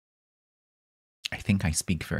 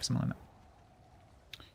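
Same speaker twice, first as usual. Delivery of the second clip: whisper; 7 semitones higher, 9 dB quieter, slower than usual. Real, not acted. Trailing aside whispered to self.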